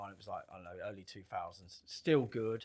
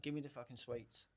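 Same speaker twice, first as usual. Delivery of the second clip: quiet warning; 11 dB quieter than usual. Retold or imitated. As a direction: keep it down, a calm threat to the kid. First telling the boy off.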